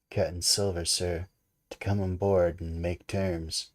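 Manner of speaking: usual speaking voice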